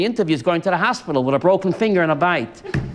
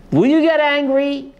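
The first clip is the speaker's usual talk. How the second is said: higher, louder rhetorical challenge; challenging cry; 8 semitones higher, 3 dB louder than usual. Real, not acted. Preacher calling out to his listeners to challenge them. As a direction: throw the question out at them.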